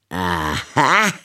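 jeering cry of triumph; scornful aha. A narrator's reading. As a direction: catch him out with a sneer. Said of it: "rief sie höhnisch".

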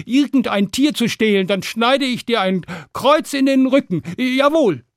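high-pitched boasting after a stammer; blustering hero. Acted. Stan threatening the dragon to scare it off, blustering. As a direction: puff yourself up and threaten.

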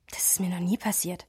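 breathy confiding whisper, no voice. Real, not acted. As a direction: let it slip out under the breath. Zugeflüstertes Geständnis. (geflüstertes Geständnis)

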